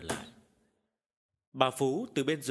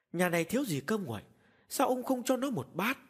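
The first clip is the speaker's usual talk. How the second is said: mild scolding; 8 semitones higher than usual. A narrator's reading. Wife scolding husband.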